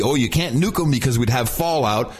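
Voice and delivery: normal talking voice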